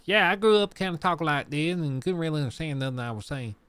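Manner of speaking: a hint of a mountain West Virginia accent; thick West Virginia accent. Retelling how the YouTuber once talked, light West Virginia accent. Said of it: "a very thick accent".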